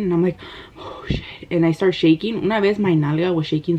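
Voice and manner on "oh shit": hushed, scared little oath; retold fright. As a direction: let it out softly, imitating sudden fear. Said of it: "I get scared for no reason".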